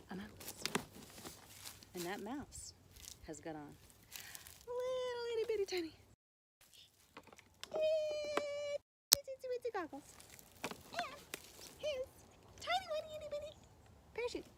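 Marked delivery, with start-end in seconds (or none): 4.7-14.5 put-on very high teeny-tiny voice; cutesy tiny. A narrator's reading. Storyteller listing a mouse's tiny gear, very high cutesy voice. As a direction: squeak out each little item.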